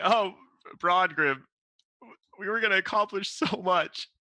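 high wailing sob; fake weeping. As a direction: wail it out, high and loud. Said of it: "openly weep, like, s-, super loud".

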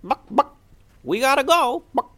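squeaky chicken asking to play. Acted. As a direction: cluck it like a chirpy hen. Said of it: "one of the birds said".

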